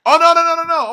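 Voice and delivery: high, loud flustered no-no-no; excited no. Real, not acted. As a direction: blurt it out as it hits you. Sudden realization outburst.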